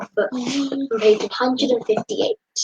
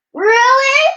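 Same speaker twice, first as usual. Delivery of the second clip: much higher, loud astonished question; surprised; 12 semitones higher, 7 dB louder than usual. A narrator's reading. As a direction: squeak it out in amazement. Jack amazed at the castle.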